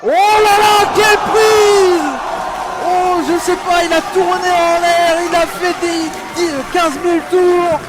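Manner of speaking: excited shouting play-by-play; wrestling commentator. Real, not acted. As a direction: yell it out, amazed by the move. Amazed commentator calling a move.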